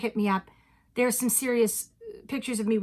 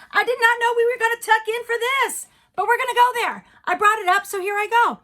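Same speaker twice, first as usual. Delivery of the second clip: higher, louder excited buildup; 10 semitones higher, 5 dB louder than usual. Acted. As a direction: psych yourself up to tell it. Excited high reveal.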